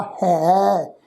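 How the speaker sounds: ਹੈ stretched long, mouth fully open; open ai vowel. Shown as a demonstration. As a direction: hold a wide, open vowel in ਹੈ. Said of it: "ਪੂਰੀ ਤਰ੍ਹਾਂ ਖੋਲ੍ਹ ਕੇ ਉਚਾਰਨ". (ਹੈ ਨੂੰ ਮੂੰਹ ਖੋਲ੍ਹ ਕੇ ਲਮਕਾਓ)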